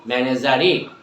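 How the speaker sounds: fast, clear tongue-twister drill. Shown as a demonstration. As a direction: rattle it off quickly. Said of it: "try to say more and more".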